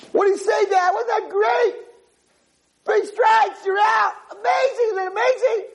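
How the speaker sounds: very high squealing shout; hammed-up triumph, every phrase shrieked; ecstatic kid. Kid celebrating three strikes to Dad, ecstatic.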